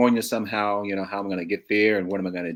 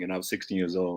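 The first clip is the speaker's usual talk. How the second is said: ordinary talk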